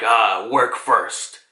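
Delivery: low, slow imitation of a stern agent. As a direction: draw it out, all business. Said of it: "he's always been this stern".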